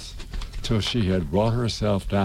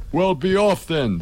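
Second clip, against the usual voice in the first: sulky; 6 semitones higher, 3 dB louder than usual. A narrator's reading. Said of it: "in a sulky tone".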